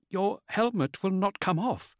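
high-pitched, concerned woman; high character voice, gently anxious; Cyrene, concerned. A narrator's reading. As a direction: lift the voice high for a worried woman.

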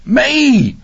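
very high, excited cry; high-pitched holler of delight; near scream. A narrator's reading. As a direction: burst out almost screaming. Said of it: "Rocky fairly screamed".

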